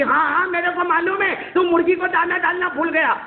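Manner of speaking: high, mocking mother sneering at his excuse; sarcastic mother. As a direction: throw his excuse back at him.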